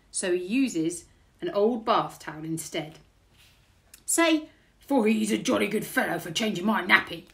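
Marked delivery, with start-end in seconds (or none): 4.1-7.3 loud burglar prompt; booming burglar, falling off; burglar character. A narrator's reading. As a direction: instruct the baby heartily. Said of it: "says Burglar Bill".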